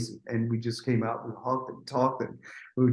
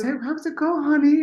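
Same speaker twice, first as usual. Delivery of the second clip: much higher-pitched imitation of a woman; Whitney greeting; 12 semitones higher than usual. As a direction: greet someone in a put-on high voice.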